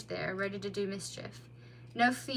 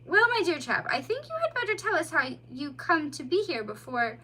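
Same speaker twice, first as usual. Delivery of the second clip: higher, louder worried voice; concerned mole; 6 semitones higher, 4 dB louder than usual. A narrator's reading. Mole to the bleeding otter, concerned. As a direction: fuss over the wounded child.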